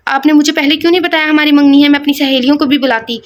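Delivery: ordinary delivery, nothing marked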